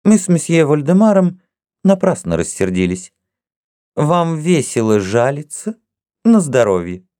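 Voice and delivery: put-on high, teasing voice of a young princess; playful young princess. A narrator's reading. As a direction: tease each suitor in turn, lightly mocking. Zinaida answers coolly.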